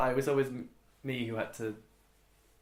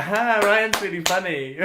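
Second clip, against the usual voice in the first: loud, high, slow mock family praise; hammed-up relatives cheering him on; family laughing along; 10 semitones higher, 11 dB louder, slower than usual. Family cheering Ryan's impressions, loud and hammed-up. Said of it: "it would always be like".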